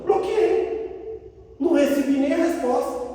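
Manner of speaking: high-pitched punchline; comic confession. Retold or imitated. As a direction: toss it out high, owning up playfully. Repeats the blocking punchline. (confissão brincalhona em voz aguda)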